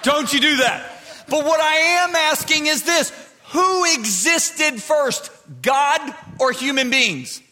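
high, loud pulpit shout; full-force preaching. Real, not acted. Preacher challenging his congregation, full-force preaching. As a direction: preach it hard, stretching key words.

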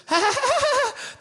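very high, loud mock laughter; hammed-up shrieking laugh; imitated wife's cackle. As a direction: imitate a wife bursting out laughing. Acts out wife's laughing reaction.

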